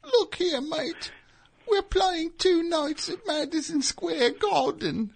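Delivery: very high, soft miniature Englishman; tiny falsetto with an English accent; Peter Grant impression. Mini band manager announcing the gig, very high soft voice. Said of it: "his voice was way higher because he was so small".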